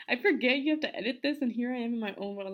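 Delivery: loud, fast, laughing self-mockery; giggly. Real, not acted. Laughing to Caesar about being in her own world. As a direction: rattle it off through giggles.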